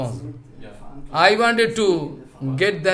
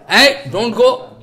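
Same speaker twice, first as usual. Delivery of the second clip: mock guard warning; 5 semitones higher, 5 dB louder, slower than usual. Gatemen blocking the sages.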